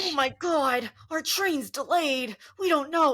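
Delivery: mock the travellers' panic; hammed-up boomer panic; freaking-out boomers. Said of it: "they're all having a freak out".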